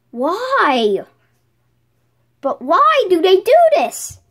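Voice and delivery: ordinary talk, nothing marked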